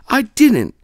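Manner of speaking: high, loud whiny denial. Acted. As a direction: deny it like a caught bully. Mars Bar denies.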